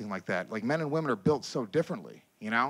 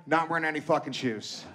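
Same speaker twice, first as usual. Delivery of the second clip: ordinary talk, nothing marked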